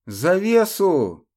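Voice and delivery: loud, drawn-out cry; shouted order. Acted. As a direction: yell it across the battlefield. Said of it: "выкрикнул Алекс".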